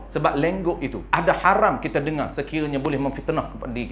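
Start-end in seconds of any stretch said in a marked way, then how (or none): none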